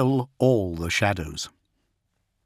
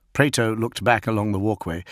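ordinary delivery, nothing marked

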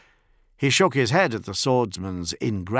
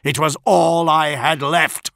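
louder, furious through the teeth; dishonoured captain snarling at the traitor; snarled; 7 semitones higher, 7 dB louder than usual. A narrator's reading. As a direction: spit the accusation at him. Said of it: "he snarled".

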